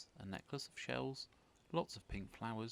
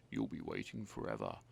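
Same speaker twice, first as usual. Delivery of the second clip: much lower sneering rogue; smug villain; 13 semitones lower than usual. Acted. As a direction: sneer it down at her. Reginald mocking Betty.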